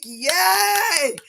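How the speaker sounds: high, loud yay; real joyful cheer; excited cheer. Real, not acted. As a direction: celebrate with him. Celebrating viewer's finished radiation.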